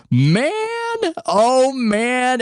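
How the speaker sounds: high, drawn-out goofball reaction; goofy wowed "man" that leaps up; Reggie Miller. Retold or imitated. Host mimics announcer reacting to a crazy shot, goofball voice. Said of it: "he's got that goofy, that goofball voice".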